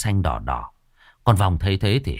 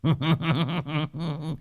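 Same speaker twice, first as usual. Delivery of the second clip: high, thin cackle; cackling ghost laugh; 8 semitones higher, 6 dB quieter than usual. Acted. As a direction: cackle like a mocking spirit. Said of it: "cười khanh khách". (tiếng cười khanh khách của vong)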